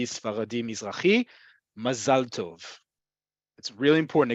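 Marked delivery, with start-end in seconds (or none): 1.8-2.8 "mazal tov" slowly, stressed ma-ZAL TOV; modern Hebrew pronunciation. Shown as a demonstration. Teacher insists on Israeli "mazal tov", slow ma-ZAL TOV. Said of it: "In modern Hebrew".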